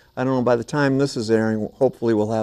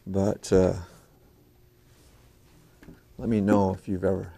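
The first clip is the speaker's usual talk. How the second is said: ordinary talk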